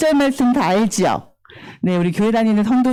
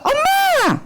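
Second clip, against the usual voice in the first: high-pitched demonstration call; child calling mom; much slower than usual. Shown as a demonstration. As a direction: call out like a little kid. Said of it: "엄마 불러볼까?".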